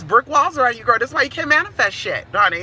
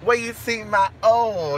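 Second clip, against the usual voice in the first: ordinary talk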